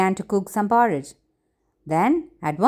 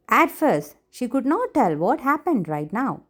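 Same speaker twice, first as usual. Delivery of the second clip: usual speaking voice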